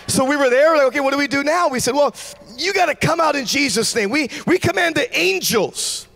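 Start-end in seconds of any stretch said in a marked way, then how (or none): none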